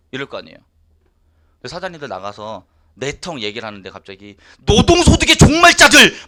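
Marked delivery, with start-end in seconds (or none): 4.6-6.3 아주 높고 크게 구호처럼 외치기; 거리에서 구호 외치듯 힘껏; 외치고 다니기. Retold or imitated. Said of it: "막 이러고 막 다니잖아요". (밖에서 구호처럼 외치고 다니는 사람 흉내, 아주 큰 외침)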